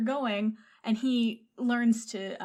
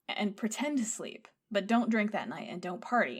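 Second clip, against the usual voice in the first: normal talking voice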